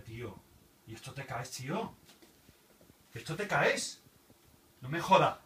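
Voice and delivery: usual speaking voice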